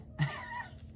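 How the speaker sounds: sudden high airy giggle, almost no voice; high breathy laugh. Real, not acted. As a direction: burst into a breathy giggle at their nerve. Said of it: "That's kinda why I'm laughing".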